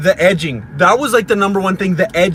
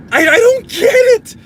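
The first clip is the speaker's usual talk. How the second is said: high, loud exasperated outburst; 8 dB louder than usual. Real, not acted. Ranting about the weeds outgrowing grass, exasperated. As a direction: cry it out in baffled frustration.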